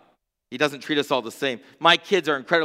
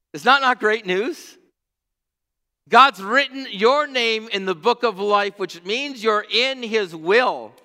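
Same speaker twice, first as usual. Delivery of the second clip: louder, higher, slow preaching build; preaching at full force; 6 semitones higher, 4 dB louder than usual. Acted. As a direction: proclaim it to the whole church.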